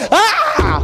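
sudden high yelp of frustration with a laugh; a fed-up parent's shriek, half laughing; exasperated yelp. Retold or imitated. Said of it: "you're gonna go".

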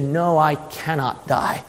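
low, soft, steady refusal; resolute Jesus. Acted. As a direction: answer quietly and without budging. Jesus answers quietly.

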